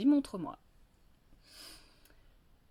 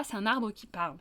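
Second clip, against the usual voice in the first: usual speaking voice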